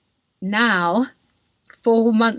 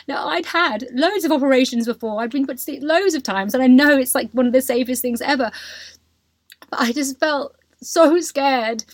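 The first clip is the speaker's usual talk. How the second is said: ordinary talk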